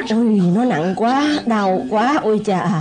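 groaning in pain. Acted. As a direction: moan about an aching back. Father complains of pain. (rên rỉ vì đau lưng)

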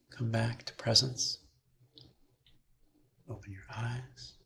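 calm teacher guiding a mother-love meditation, unhurried; guided meditation. Acted. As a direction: lead them gently through the breath.

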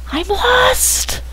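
very high, soft, helpless whine. Real, not acted. Player admits being lost, in a high helpless whine. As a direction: let it climb into a tiny high voice.

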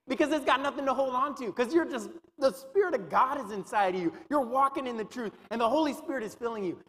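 high, loud, fervent preaching; preacher building to a loud climax; full-force preaching. Acted. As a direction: lift it up and drive it home to the congregation.

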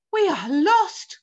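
loud, drawn-out despairing cry. A narrator's reading. Ladybird as the peach falls, crying out. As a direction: wail it slowly, high and loud. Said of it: "cried the ladybird".

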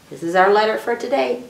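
ordinary talk

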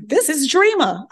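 high, loud sing-song reply; the operator Dreama, voiced high and chirpy; operator's phone voice. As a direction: answer the call cheerily in a high voice.

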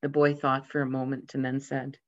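ordinary delivery

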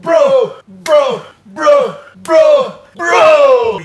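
loud, high repeated cries of bro; excited brother greeting shouted. Acted. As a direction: yell across to your brother like a fan.

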